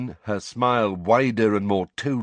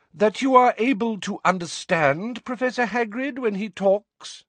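high, carrying, condescending question; ringing voice; 14 semitones higher, 3 dB louder than usual. A narrator's reading. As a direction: make it ring out across the class. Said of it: "in a ringing voice".

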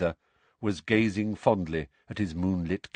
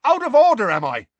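very high, loud incredulous retort; indignant shout; 14 semitones higher, 9 dB louder than usual. A narrator's reading. Seamus throws Ron's words back, shouting indignantly. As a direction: shout it back as an outraged question. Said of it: "shouted Seamus".

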